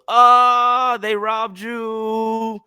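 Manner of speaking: high, drawn-out mock wail; imitated shocked outcry. Man hearing the price paid, imitated high shocked wail. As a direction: wail it long and high in mimicry.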